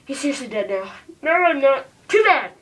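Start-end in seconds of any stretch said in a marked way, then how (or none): 1.2-1.9 high, loud objection; shouted protest. Pretend. Supposedly dead character protests. 2.1-2.5 high, loud, curt retort; shouted dismissal. Pretend. Killer answers the protest.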